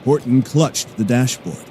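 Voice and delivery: normal talking voice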